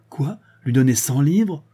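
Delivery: father scandalised at giving his son money; outraged disbelief. A narrator's reading. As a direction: balk at the money in indignation.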